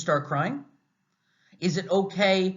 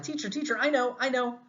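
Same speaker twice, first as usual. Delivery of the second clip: high, eager hand-raiser calling out; eager student; 8 semitones higher than usual. Retold or imitated. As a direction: play the keen student begging to answer, voice up high. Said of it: "I was always the one who was like".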